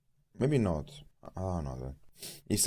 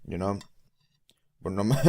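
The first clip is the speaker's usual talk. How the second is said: normal talking voice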